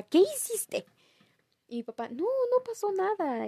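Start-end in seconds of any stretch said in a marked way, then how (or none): 0.0-0.9 mom scolding. Retold or imitated. Mom scolding dad, far. 2.1-3.5 higher, slow nervous excuse; a hint of a flustered father making excuses; dad justifying himself. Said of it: "mi papá justificándose".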